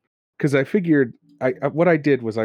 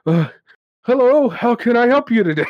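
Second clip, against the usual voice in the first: higher and louder, hammed-up puffing shopkeeper; breathless Bomar; 12 semitones higher, 4 dB louder than usual. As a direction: pant it out like you just ran over. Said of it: "He's really out of breath".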